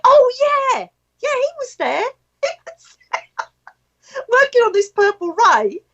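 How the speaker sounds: very high, giggly replay of remembering; excited recognition. Retold or imitated. Recalling who was there to a friend, excited recognition. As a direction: squeal it lightly through laughter. Acting own delighted recollection to friend.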